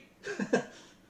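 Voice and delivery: short, high chuckle; real laugh. Real, not acted. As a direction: chuckle after a teasing remark. Game master laughs after teasing reminder.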